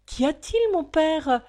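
high, loud alarmed call; startled daughter crying out. Acted. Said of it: "s'écria-t-elle".

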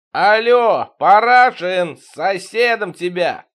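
loud high call to a window; shouting up. Acted. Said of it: "задрал голову к небесам и крикнул". (громкий крик наверх)

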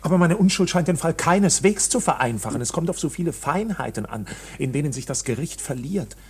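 ordinary talk, nothing marked

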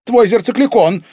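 put-on high, loud girl's shout; shrieked reply to the captor; urgent answer. A narrator's reading. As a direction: blurt the answer out. Girl answers villain at full voice.

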